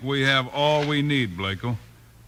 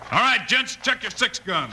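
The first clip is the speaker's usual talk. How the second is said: rallying call; 8 semitones higher than usual. Acted. Bill rallying the men for showdown.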